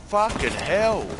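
loud, high-pitched swearing; amazed outburst. Real, not acted. Gamer reacting to a teammate's play, amazed outburst. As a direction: blurt it out, pitch leaping up.

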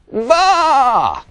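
loud, very high, drawn-out bleat; excited sheep bleat. A narrator's reading. Sheep asking Pete about his cut, excited bleat. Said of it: "they exclaimed excitedly".

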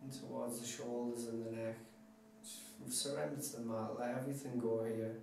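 hushed, unhurried guide into deep relaxation; guided meditation. Acted. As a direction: talk them softly through a body scan, letting each phrase settle. Leads relaxation body scan.

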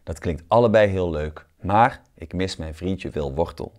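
usual speaking voice

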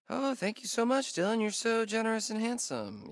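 high, sugary mock gratitude; sarcastic thanks. Teammate mocks Dylan with sarcastic, high gushing thanks. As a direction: gush it back at him, dripping sarcasm.

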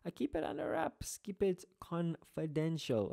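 hushed, high-pitched mock secrecy; soft and high, playfully keeping it confidential; mock secretive. Teacher joking about hiding personal details, mock secretive.

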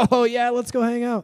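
high-pitched burst of mock excitement; sudden energy. Friend shows up to hang out, suddenly excited. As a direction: perk right up, eager to go. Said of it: "you've got all this energy".

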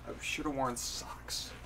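usual speaking voice

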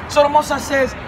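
ordinary talk, nothing marked

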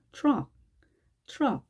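트럭 as English truck, T melting into R; fused TR. Shown as a demonstration. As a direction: start 트럭 almost like "ch", with curled R. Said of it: "T와 R이 융화된 듯한 느낌".

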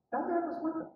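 put-on high, soft girl's voice; lightened, high-pitched accusation; accusing servant girl. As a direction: point him out in a small high voice.

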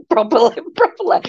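high-pitched mock-posh "properly". Proving she can talk properly, badly faked posh voice. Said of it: "imagine me doing a posh voice".

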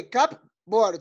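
"cupboard" drawn out in two separate beats; slow word model. Shown as a demonstration. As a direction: say "cupboard" slowly, split into cup and board. Teacher models the word for pupils. ("cupboard" despacio, separando las dos partes)

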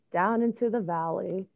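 gentle storybook narration; reading to children. A narrator's reading. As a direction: read it like bedtime story. Reads a picture book aloud.

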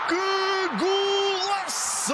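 high, drawn-out goal yell; explosive goal commentary. Real, not acted. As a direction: scream the goal, stretching every word. Goal scored in live commentary. (relato de golo explosivo, gritado e arrastado)

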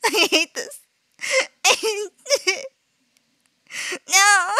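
high, tearful whimpering; loud frightened weeping words; crying in fear. Real, not acted. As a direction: sob it out, truly scared. Sobbing with fright after scare.

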